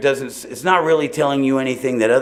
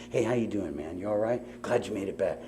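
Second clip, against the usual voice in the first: gentle welcome; 3 semitones lower, 13 dB quieter than usual. Shown as a demonstration. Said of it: "our job is to be loving to each other".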